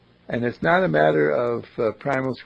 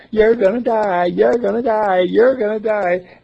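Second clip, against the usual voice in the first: jester; 7 semitones higher, 8 dB louder than usual. Retold or imitated. Clown jester taunting lady.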